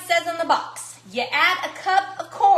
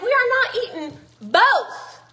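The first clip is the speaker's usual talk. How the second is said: raised, higher mock-stern mom; scolding the children; 7 semitones higher, 4 dB louder than usual. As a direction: warn the kids firmly. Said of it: "I was like".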